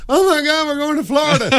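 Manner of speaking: giddy cry of holiday anticipation; excited tourist. Acted. Georgia family setting off for Florida, excited.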